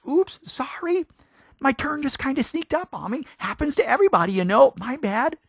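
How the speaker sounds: high, sugary sorry-voice; sheepish apology. Retold or imitated. Driver apologizing after cutting someone off, sheepish and high. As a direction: play the innocent driver. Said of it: "we mouth the words".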